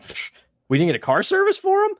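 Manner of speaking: incredulous, high-pitched question; scoff, then question it in high-pitched disbelief; retold disbelief. Acted disbelief at the errand.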